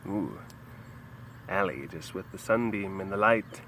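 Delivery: ordinary talk, nothing marked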